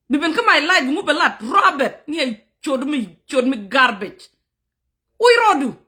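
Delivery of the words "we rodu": angry outburst. Real, not acted. Angry live rant at commenter.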